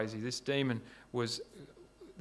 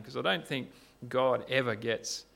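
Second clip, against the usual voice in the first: usual speaking voice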